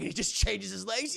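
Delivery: normal talking voice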